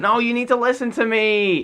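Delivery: high, loud insistent whine; hammed-up whiny kid; Hiccup whining. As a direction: whinge it like a frustrated teenager. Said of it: "He's really whiny".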